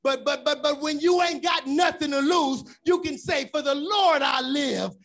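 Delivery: rising shouted sermon cadence; full-force preaching. Acted. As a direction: preach it out to the whole church. Preaching against the enemy.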